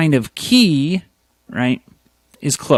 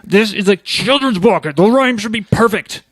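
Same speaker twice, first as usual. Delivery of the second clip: indignant; 7 semitones higher than usual. Retold or imitated. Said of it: "I'll be like".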